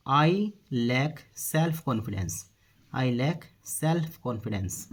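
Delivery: slow, word by word; measured dictation-style delivery; slow model sentence. Shown as a demonstration. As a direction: say it slowly for learners, spacing each word. Teacher models the English sentence.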